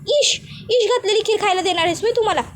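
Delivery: high, fast shocked cry of disbelief; shocked outburst. Retold or imitated. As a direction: recoil from the bowl in alarm, squeaking. Aunt shocked at poison. (धक्का बसलेला, उंच आवाजात वेगाने ओरडणे)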